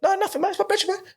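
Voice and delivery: high, sing-song playing innocent; mock innocent. Telling bouncers it's nothing, in a high innocent voice. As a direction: act all friendly to fool the bouncers. Placating bouncers in retold scene.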